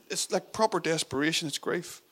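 ordinary talk, nothing marked

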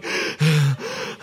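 sharp gasp of shock; imitated shocked gasp. Pastor imitating the shocked grandmother's gasp. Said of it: "the lady's going".

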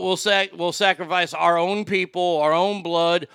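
ordinary talk

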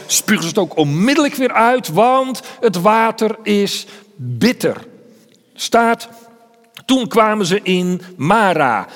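impassioned preacher retelling Israel at the well; preaching at full force. Acted. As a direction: paint the desert scene for the congregation with rising passion. Vivid retelling of the desert story.

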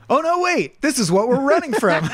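high, surprised Leela impression; Leela quote. Leela realizing what they flee, in a high voice. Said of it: "and then goes".

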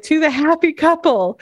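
high, mock-awkward toast; lightly voiced awkward toast; sheepish toast. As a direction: raise a glass, suddenly embarrassed. Acted quote of character at wedding.

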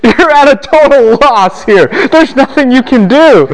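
throwing up his hands at a hopeless mess; incredulous, exasperated lecturer. Real, not acted. Exasperated over David's impossible choice.

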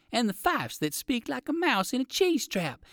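high and squeaky like a mouse. A narrator's reading. Narrator mimicking fifes, high and squeaky. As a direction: pitch it up like a little fife.

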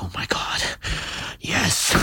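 hushed, whispered mock excitement; mock excited whisper. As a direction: whisper it like an eager eavesdropper. Imitating naked guys whispering excitedly.

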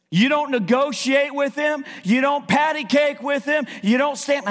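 fired-up sermon voice; impassioned preaching. Real, not acted. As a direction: preach it with force, punching key words. Preaches at full force.

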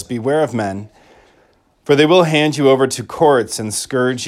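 solemn liturgical reading; gospel proclamation. Acted. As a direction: proclaim it from the ambo.

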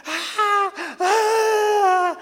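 high, loud mocking shriek; high hammed-up fan scream; mock crowd shriek. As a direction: imitate the crowd screaming from the railings.

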